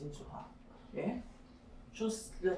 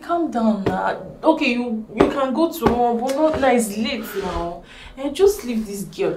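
loud, insistent calming; raised-voice appeal to calm down; urgent pleading; 4 semitones higher, 16 dB louder than usual. Acted. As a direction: plead over their anger to hold them back. Pleads with angry partner to calm down.

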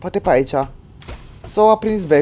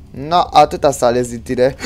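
normal talking voice

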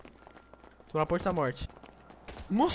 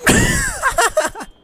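loud high burst of laughter; high shrieking laugh; 6 dB louder than usual. Real, not acted. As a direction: crack up loudly. Laughing after a kill.

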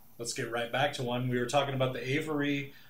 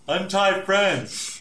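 slow, higher royal command; commanding king voice; 7 semitones higher, 7 dB louder, much slower than usual. Acted. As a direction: order it like a king.

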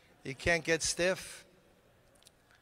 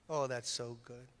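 usual speaking voice

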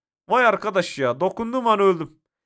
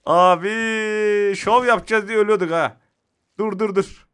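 usual speaking voice

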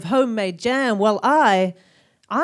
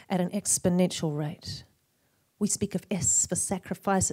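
normal talking voice

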